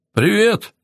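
high, rising cheery hello; bright-spirited, high-pitched greeting; cheerful greeting. A narrator's reading. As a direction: greet them merrily, pitch lifted well up. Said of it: "весело поздоровался он".

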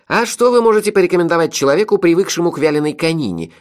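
high, loud needling question; snide. A narrator's reading. Melifaro needles the innkeeper about his tastes, snide. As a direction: ask with mock innocence, sliding down from a high start. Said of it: "ехидно спросил Мелифара".

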